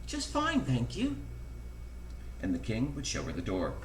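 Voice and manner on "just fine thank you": high-pitched, dainty politeness; polite princess. A narrator's reading. Princess answering the queen at breakfast, high polite voice. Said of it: "would politely say".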